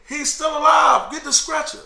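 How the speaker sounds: high, loud guard's shout; urgent shout. Retold or imitated. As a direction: yell it for the stretcher. Sergeant calls out urgently.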